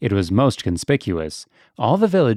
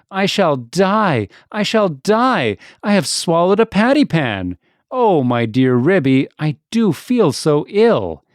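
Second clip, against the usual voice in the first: howling; 5 semitones higher, 4 dB louder than usual. A narrator's reading. Duchess fears she swallowed the patty pan, howling loudly. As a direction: wail it out like a stricken cat. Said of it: "Duchess set up a howl".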